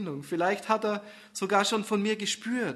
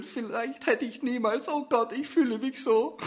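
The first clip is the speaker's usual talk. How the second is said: higher, choked-up voice; tearful; 7 semitones higher than usual. Acted. Susanne breaking down in the car, higher and tearful. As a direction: let it break into tears. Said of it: "Augen mit Tränen füllen".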